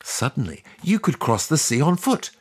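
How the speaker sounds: usual speaking voice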